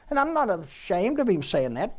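normal talking voice

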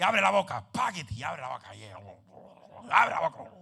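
acted-out parental command; imitated father giving medicine. Preacher recalling dad forcing medicine on him, mock insistent order. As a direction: play dad insisting on the mouth opening. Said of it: "él me tapaba la nariz".

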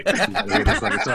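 laughing excitement. Real, not acted. Excitedly urging others to hear.

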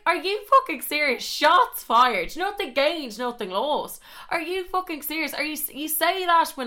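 high-pitched indignant retort; incredulous outrage. Retold or imitated. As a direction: throw it back at him in disbelief. Acted outraged reply to ex.

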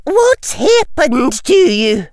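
high, drawn-out cry of shock; alarmed cry. A narrator's reading. Professor to the staggering caretaker, high alarmed cry. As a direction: cry it out slowly, pitched high. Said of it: "she cried".

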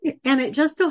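high, loud excited exclaim. Real, not acted. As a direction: burst out with delight. Excited after finding ring.

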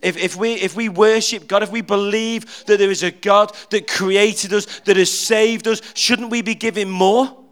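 usual speaking voice

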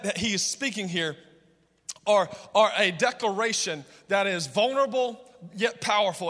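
usual speaking voice

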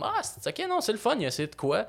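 high, soft surprised reaction; a fan who suddenly gets it; pleasantly surprised fan. Retold or imitated. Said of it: "qui étaient comme".

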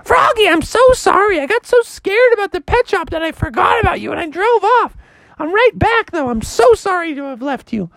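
very high apologetic mother; high mom voice. Acted. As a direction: play the flustered mom up high. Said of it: "He was like".

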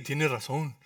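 imitating an anxious believer giving in; mock worried voice. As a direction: fret like someone caught up in worry. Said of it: "vamos a decir".

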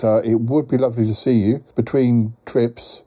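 usual speaking voice

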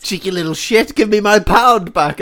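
mock angry shout. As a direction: bark at him to hand it back. Mock angry retort from window.

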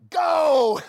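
loud, high Power Ranger shout; mock battle cry. Pastor joking about becoming a super disciple, loud mock shout. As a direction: yell it like a hero transforming.

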